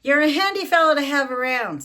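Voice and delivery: loud, high cheerful father; hammed-up proud father; hearty dad voice. Dad's voice in book.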